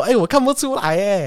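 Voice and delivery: high, overplayed disbelief; hammed-up salesman surprise; fake surprise. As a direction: flatter with mock amazement. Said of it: "然后他就说".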